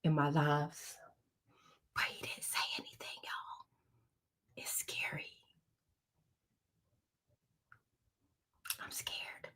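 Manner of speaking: hushed, half-joking scared whisper; real whisper, mock scared. Real, not acted. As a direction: whisper like someone might be listening. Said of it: "I don't know why I'm whispering".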